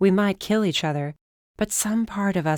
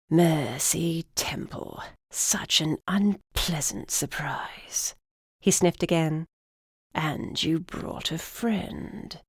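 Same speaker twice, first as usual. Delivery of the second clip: put-on slow whispery old man; 5 dB quieter, slower than usual. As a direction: rasp it out like a cranky old man. Old griffin greets her.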